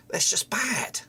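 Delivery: breathy whispered dismay under the breath; whispered disgust. Real, not acted. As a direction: mutter it to yourself in disgust. Muttering in frustration at poor play.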